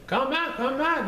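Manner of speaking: high calling yell; old couple calling him back; calling after him. Acted. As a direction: shout after the runaway cookie. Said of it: "they yelled".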